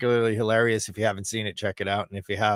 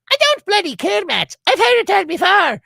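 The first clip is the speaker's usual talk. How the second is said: high, loud fed-up Irish mom; Irish mother; 9 dB louder than usual. Retold or imitated. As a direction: imitate mom snapping back in an Irish accent. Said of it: "She actually speaks with an Irish accent".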